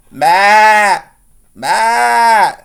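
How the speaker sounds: loud, high-pitched goat call; bleating goat. A narrator's reading. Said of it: "said the goat".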